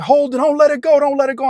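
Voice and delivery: high, urgent crowd voice; bystanders shouting. Retold or imitated. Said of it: "the people are like".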